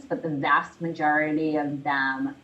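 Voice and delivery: usual speaking voice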